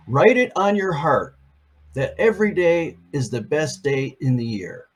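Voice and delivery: lifted, storybook reading of the quote; picture book reading voice; read-aloud quote voice. Acted. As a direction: read it out to children with a bright start.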